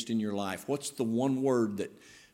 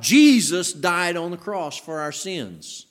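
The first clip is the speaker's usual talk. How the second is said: "Jesus" high, loud and stretched; big lifted emphasis on "Jesus"; stress on Jesus; 6 semitones higher, 9 dB louder, slower than usual. Shown as a demonstration. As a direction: hit "Jesus" hard, then ease down through the line. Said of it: "we could emphasize the person of Christ".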